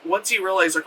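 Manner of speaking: usual speaking voice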